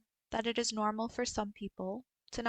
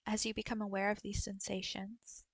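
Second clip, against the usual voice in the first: guided meditation. Acted. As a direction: guide them gently through a body scan, unhurried. Leads a body scan meditation.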